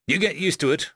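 plain reading voice

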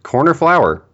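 high, impatient order-taker voice; bored cashier repeating the question; impatient drive-thru worker. Acted.

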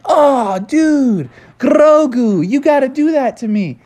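put-on high-pitched awestruck kid; acted thrilled classmates; excited begging. Excited kids beg for a turn.

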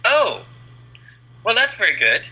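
light imitation of a delighted boss; pleased surprise. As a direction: editor reacting to the caller, mildly surprised and pleased. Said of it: "He's like".

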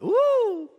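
high, sharp ooh of a shiver; a real shiver escaping as ooh; chilled ooh. Real, not acted. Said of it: "I just got a chill".

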